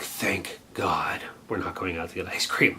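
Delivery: breathy whispered sigh of relief. Retold or imitated. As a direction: whisper it like a private thank-you, retold. Inner relief at going home.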